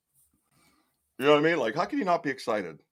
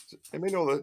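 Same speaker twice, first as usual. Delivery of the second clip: ordinary talk, nothing marked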